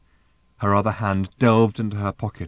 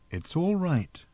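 higher, soft reassurance; gentle; 7 semitones higher, 5 dB quieter, slower than usual. A narrator's reading. Arthur reassuring panicking Random, gently. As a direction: soothe her softly. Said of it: "said Arthur gently".

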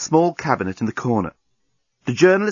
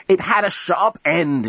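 journalist voice; 7 semitones higher, slower than usual. A narrator's reading. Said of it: "interrupted the journalist".

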